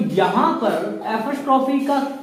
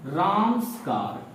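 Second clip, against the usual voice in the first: slow, careful model reading; 3 semitones lower, 4 dB quieter, slower than usual. Shown as a demonstration. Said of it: "आप इस तरीके से इसको लिखने वाले हैं".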